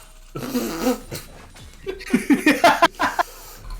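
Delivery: loud, high cackle building up; high laughing fit. Real, not acted. Co-caster cracking up mid-broadcast, high laughing fit. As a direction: burst out laughing and keep going.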